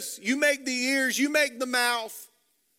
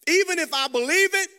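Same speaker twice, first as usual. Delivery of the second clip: higher, louder burst of doubt; forceful Moses objection; 8 semitones higher, 6 dB louder than usual. Acted. Preacher voicing Moses' objection at full force, louder and higher. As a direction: throw out the objection hard.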